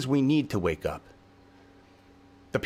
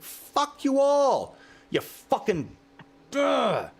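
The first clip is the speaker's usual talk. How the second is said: screaming at the world; 11 semitones higher, much slower than usual. Retold or imitated. Speaker retells yelling at everyone from his yard, a raw high scream. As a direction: let it rip into the dark at four in the morning. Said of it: "I scream and scream".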